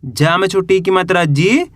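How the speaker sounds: ordinary delivery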